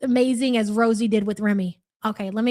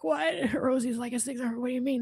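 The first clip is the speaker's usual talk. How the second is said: much softer mock-incredulous aside; imitated disbelief; 9 dB quieter than usual. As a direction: voice a doubtful viewer under your breath, half laughing. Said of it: "And you're like".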